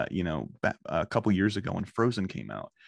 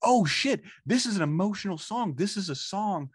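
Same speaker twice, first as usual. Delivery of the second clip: sudden realization; 7 semitones higher than usual. Retold or imitated. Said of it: "I was like".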